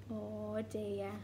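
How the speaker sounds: plain reading voice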